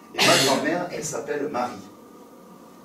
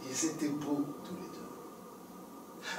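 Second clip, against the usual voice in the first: slow breathy hush, almost voiceless; slow tender whisper; 14 dB quieter, much slower than usual. A narrator's reading. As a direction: breathe it softly as if moved. Tender narrator aside.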